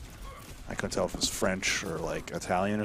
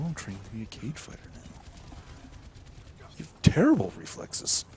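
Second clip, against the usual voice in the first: movie quote impression; 5 semitones higher than usual.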